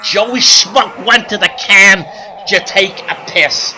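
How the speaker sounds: loud, hammed-up club comic working the crowd; brash stand-up comedian. Joey Schmuck playing the Copacabana crowd, brash comic patter. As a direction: belt it out like a hack nightclub act.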